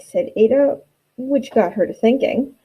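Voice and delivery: lively rhyming storybook read; animated read-aloud of a rhyming picture book; reading to children. Acted. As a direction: read it aloud with bounce for young kids.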